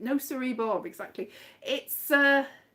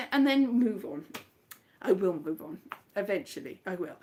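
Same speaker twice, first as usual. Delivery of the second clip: mock sad; 6 semitones lower, 4 dB quieter than usual. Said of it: "cry like this".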